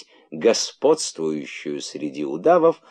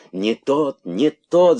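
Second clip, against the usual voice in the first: шипение удавов; 6 semitones higher, 4 dB louder, much slower than usual. A narrator's reading. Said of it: "зашипели удавы".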